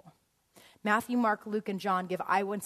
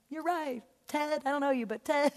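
higher, excited self-quote; mock eager agreement; 5 semitones higher than usual. Speaker retelling her reply to a preacher, mock eager. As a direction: gush agreement at Ted. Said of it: "I'm like".

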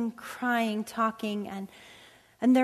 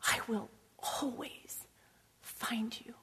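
tender daddy; 8 dB quieter, slower than usual. Retold or imitated. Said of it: "like a good daddy".

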